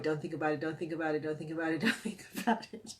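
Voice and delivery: quick monotone mantra, repeated without a breath; rapid self-talk. Retold or imitated. Retelling frantic avoidance self-talk in pain.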